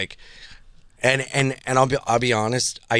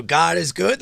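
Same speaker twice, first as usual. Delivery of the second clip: call and response; 6 semitones higher than usual. Retold or imitated. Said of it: "And I go".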